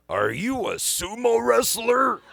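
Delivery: put-on high, slow, amazed old man; old dad voice. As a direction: ask it slowly in a wavering old man's voice. Dying dad, startled question.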